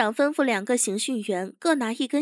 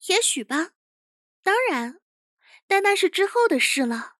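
higher-pitched character voice, unhurried; higher voice, confident chief; composed interrogation chief; 7 semitones higher than usual. Acted. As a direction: play a young interrogator who stays composed.

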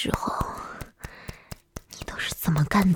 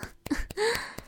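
teasing giggle; 13 semitones higher, 6 dB quieter than usual. Acted. Teasing partner.

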